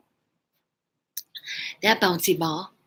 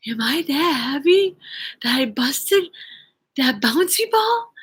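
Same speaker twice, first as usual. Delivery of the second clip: higher, halting disbelief; retold shock; 5 semitones higher than usual. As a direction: ask in mock disbelief, rising at the end. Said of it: "in kind of shock".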